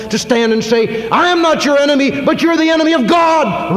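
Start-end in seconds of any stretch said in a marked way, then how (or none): 1.1-3.8 high, loud shouted defiance; defiant shout. Acted. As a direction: hurl it right at the king. Elijah defying the king.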